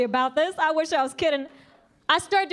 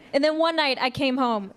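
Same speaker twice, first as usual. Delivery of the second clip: ordinary talk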